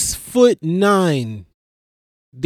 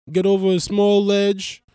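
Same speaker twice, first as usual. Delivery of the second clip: ordinary talk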